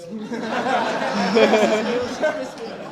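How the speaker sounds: loud burst of laughter. Real, not acted. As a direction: laugh at the setup. Laughs at own story.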